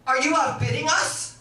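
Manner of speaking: loud, high affronted question; mock indignant challenge. German woman to rival house buyer, acted indignation. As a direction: challenge a rival, imitating outrage.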